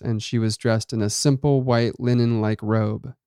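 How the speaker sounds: ordinary delivery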